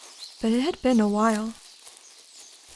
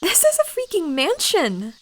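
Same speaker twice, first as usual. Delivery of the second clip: high, loud amazed exclamation; 8 semitones higher, 4 dB louder than usual. Acted. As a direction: gasp out in disbelief. Amazed at the cabin.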